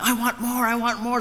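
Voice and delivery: high-pitched imitation of never-satisfied children; mock a greedy little kid begging; demanding kids. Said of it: "they kinda like".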